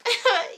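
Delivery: quick high laugh; short laugh. Real, not acted. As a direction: chuckle loudly. Laughs after punchline.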